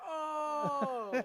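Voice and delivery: long, high, loud "oh"; retold realization. Cohost retelling when he got the joke, loud realization. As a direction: replay the moment it clicked. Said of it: "I was like".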